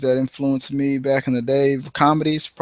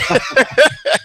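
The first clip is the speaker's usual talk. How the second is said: high cackle; 13 semitones higher, 4 dB louder than usual. Real, not acted. Laughing at own Red Fox bit.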